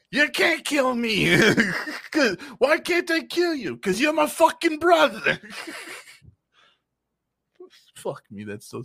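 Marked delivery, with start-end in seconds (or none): 0.1-1.4 high, slow Brooklyn comeback; slow hammed-up Brooklyn voice; mock Brooklyn. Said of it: "from Brooklyn all of a sudden". 2.1-5.4 hammed-up Brooklyn yell; mock Brooklyn shout. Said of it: "from Brooklyn all of a sudden".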